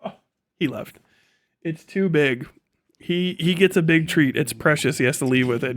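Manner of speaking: normal talking voice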